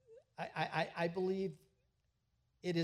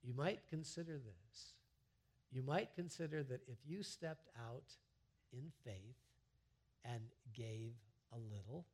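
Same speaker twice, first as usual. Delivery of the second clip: soft coaxing; 4 semitones lower, 9 dB quieter than usual. Real, not acted. Pastor softly urging the flock to step out, soft and coaxing. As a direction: drop to a soft, gentle coax.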